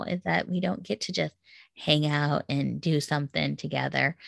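usual speaking voice

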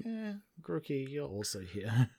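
bored, soft and slow dismissal; unimpressed. Retold or imitated. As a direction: shrug it off, flat and quiet. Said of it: "Grookey was never was like".

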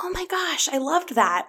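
high, delighted gasp; excited. Retold or imitated. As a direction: gush like a fan. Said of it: "I was like".

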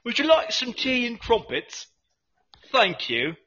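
mock-polite British tea offer; hammed-up British host; British accent. Offering tea to the co-host, mock British accent. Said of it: "the asshole British accent".

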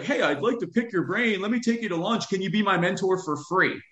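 high, put-on voice of a pushy fan asking for free mentoring; a high mock voice of someone cold-messaging Elon Musk. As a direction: squeak it out like an eager stranger begging favors. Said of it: "be like".

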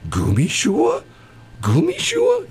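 incredulous echo, rising sharply; baffled questioning. Retold or imitated. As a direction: repeat it as a puzzled question, pitch shooting up. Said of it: "they'd look at me and they go".

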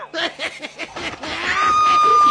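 very high shrieking maniac laugh; maniacal laugh. Acted. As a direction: cackle like a madman.

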